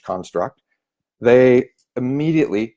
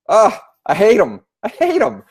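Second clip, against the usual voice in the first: disgust; 10 semitones higher, 4 dB louder than usual. Real, not acted. Scripted pitches disgust.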